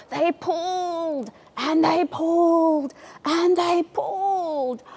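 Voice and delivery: high, slow, straining heave; slow effortful pulling chant. A narrator's reading. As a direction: heave each word like pulling hard.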